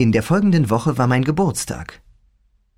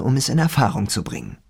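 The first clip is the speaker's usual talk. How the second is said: ordinary delivery, nothing marked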